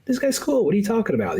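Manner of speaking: mock breezy producer. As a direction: toss it off like a fake-friendly big shot. Producer voiced being friendly.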